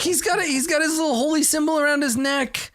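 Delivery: high defensive voice; high innocent voice. Acted. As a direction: protest innocently.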